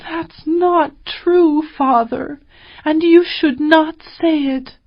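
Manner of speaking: cried out. A narrator's reading. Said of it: "she cried".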